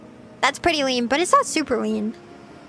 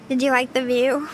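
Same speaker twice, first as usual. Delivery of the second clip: normal talking voice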